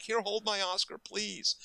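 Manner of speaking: high-pitched, gushing plea; excited, high-voiced urging; eager starstruck offer. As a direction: retell a giddy fan pressing a favour. Acts out eager offer to star.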